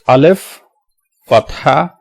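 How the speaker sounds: letter, then sign, with pauses; name each part separately, voice lifting; spelling it out. Shown as a demonstration. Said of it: "let's just spell it".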